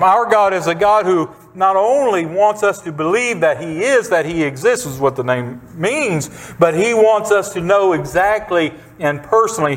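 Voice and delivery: normal talking voice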